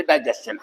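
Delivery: डाइजेशन as "dai-jes-chun", with a ch; correct pronunciation. Shown as a demonstration. Teacher confirming the proper form to students, डाइजेशन pronounced "dai-jes-chun". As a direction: give डाइजेशन its "s-ch" sound. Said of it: "सही pronunciation इसकी".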